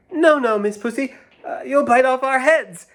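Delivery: high squeaky little mice; high squeaky mouse voices; tiny mice. Acted. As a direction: squeak back in alarm. Frightened mice answer.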